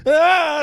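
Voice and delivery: high loud scream of shock. Retold or imitated. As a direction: scream on realizing the stranger's hand. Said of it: "Nell was like".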